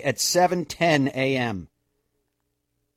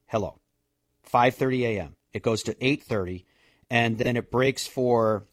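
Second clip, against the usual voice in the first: normal talking voice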